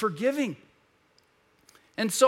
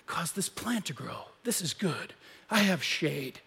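content Jonah in the shade; 4 semitones lower, 6 dB quieter than usual. Retold or imitated. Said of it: "and he's like".

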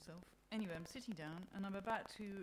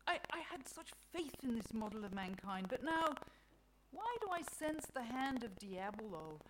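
sighing; 5 semitones higher, 6 dB louder than usual. A narrator's reading. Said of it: "Mother Nature sighs".

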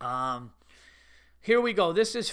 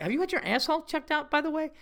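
high, fast deadpan ask; cold executive concern; dead serious question; 9 semitones higher, faster than usual. Retold or imitated. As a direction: ask like a boss about to fire someone. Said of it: "as if a C E O".

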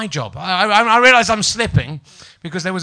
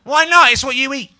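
mock indignant; 7 semitones higher than usual. Real, not acted. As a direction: demand it with playful outrage. Teasing David about porridge.

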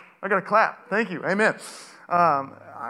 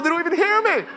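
normal talking voice

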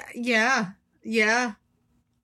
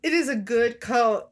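ordinary talk, nothing marked

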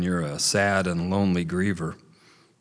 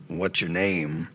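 put-on softened old beaver; 2 semitones lower than usual. A narrator's reading. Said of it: "Earl's heart began to soften".

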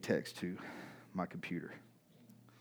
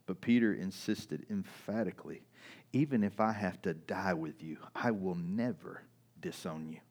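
scripture reading; 4 semitones lower than usual. Acted. Pastor reading Jesus and Peter's exchange aloud. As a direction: read the passage to the congregation.

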